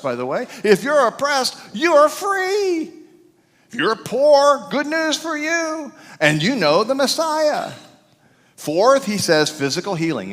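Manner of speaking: high, loud, rousing sermon delivery; full-force preaching. Acted. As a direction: preach it to the back row. Preaching the good news list.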